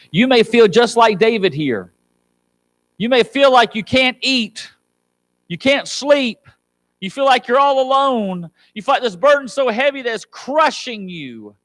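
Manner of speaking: high, urgent preacher's voice; impassioned preaching. Real, not acted. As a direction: press each hardship on the congregation, pitched high. Preaches at rising intensity.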